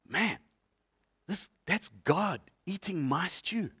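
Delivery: stunned parent at dinner, softly marvelling; awed aside. Retold or imitated. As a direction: murmur it in disbelief across the table. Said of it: "looking across the table and going".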